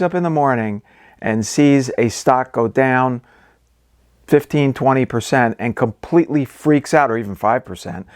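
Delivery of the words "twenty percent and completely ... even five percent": ordinary delivery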